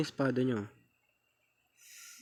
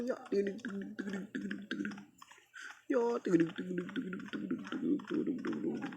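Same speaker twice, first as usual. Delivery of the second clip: horse gallop sounds; 3 semitones higher, 9 dB quieter, faster than usual. Pretend.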